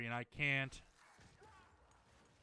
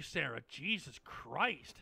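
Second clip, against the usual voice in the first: ordinary talk, nothing marked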